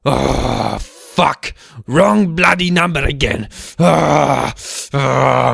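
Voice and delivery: a burst of mock annoyance; frustrated outburst. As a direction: snap at the wrong number. Angry at wrong number.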